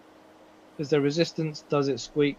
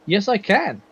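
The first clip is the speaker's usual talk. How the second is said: pleasantly surprised, higher-pitched reply; 5 semitones higher, slower than usual. Real, not acted. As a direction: say it as if surprised you can. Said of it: "Don't sound so surprised".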